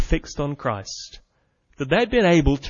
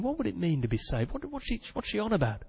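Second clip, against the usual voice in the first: puzzled musing; 6 semitones higher, 9 dB quieter than usual. Acted. Jailer's inner puzzled musing.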